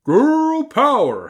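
very high, loud, drawn-out howl; howling wolf. A narrator's reading. Wolf joins the girls' battle cry, howling. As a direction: howl the words out long. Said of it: "howled the wolf".

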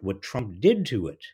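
ordinary talk, nothing marked

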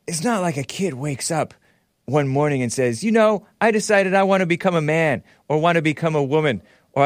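high mocking old-man voice quoting Biden; Biden impression. Radio host mocking Biden, quoting his interview in a put-on voice. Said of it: "This is my impression of him".

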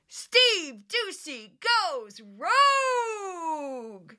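hammed-up segment title; promo announcer. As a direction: announce it like a dramatic TV teaser.

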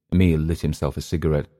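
plain reading voice

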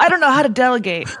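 high, loud, laughing parody of a candidate; mock candidate. Joking with the guest, mocking a candidate's fake weakness. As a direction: ham up a fake humble-brag. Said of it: "mocking a fake weakness answer".

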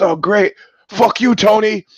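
high, loud mock shout across the water; hostile yell. Guy yelling at Tony across the lake, loud mock insult.

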